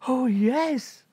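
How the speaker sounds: put-on high, eager girlish yes; high breathless agreement; eager princess.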